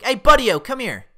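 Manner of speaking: calling the dog. Acted. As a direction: call your dog over from across the yard. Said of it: "a weird thing you call your dog".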